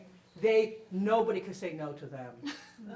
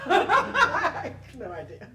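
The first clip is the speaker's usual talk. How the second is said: loud laughing disbelief, slow; 8 semitones higher, 12 dB louder, slower than usual. Real, not acted. Mother laughing about her daughter's fame, loud and delighted. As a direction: laugh it out, amazed and proud.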